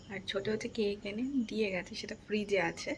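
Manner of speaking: hushed whisper so he won't wake. Real, not acted. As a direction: keep it to a whisper. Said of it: "আমি আস্তে আস্তে কথা বলছি".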